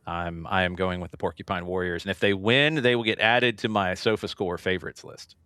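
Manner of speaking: ordinary talk, nothing marked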